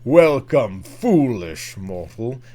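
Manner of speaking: low, slow, eerie ghost host; spooky theme park narrator, drawn out; Haunted Mansion narrator. Shown as a demonstration. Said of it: "Can you do your Haunted Mansion impression".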